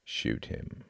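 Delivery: very low, soft command; close, low order. A narrator's reading. Jorgen orders Klaus to shoot, low quiet command. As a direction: give the order softly, right at his ear. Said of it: "said a voice all too close".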